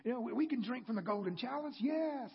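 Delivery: a lightly voiced keen promise; eager yes. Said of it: "And they said".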